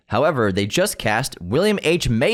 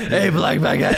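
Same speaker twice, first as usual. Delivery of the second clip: laughing ape voice; 5 semitones higher than usual. Pretend.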